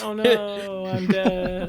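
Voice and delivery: badly faked death cry; faking death. Gamer mocks a man faking his death, feeble mock wail. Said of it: "I guess then he's faking it".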